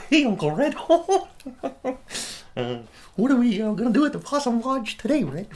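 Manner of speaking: high, loud, honking nerdy nephew; Harold. Retold or imitated. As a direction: pitch it way up with goofy hooting laughs. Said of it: "Harold, his nephew, and he'd be like".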